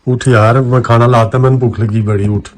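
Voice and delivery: loud, pushy wake-up calls; loud insisting. Acted. Man loudly urging the sleeping woman to eat. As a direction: push her to get up, loudly. Waking her for dinner.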